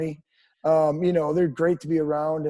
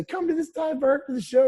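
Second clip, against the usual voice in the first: excited fan; 10 semitones higher than usual. Retold or imitated. Quoting fans inviting the band.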